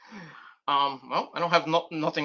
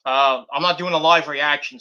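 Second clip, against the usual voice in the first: ordinary delivery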